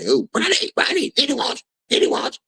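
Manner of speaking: very high, squeaky excited impression; hammed-up falsetto summons; mock happy calling. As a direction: imitate someone gleefully calling a person over.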